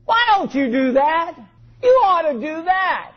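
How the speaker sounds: pushy, critical nagging; negative tone. Shown as a demonstration. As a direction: scold as if they should know better. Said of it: "We use negative tones".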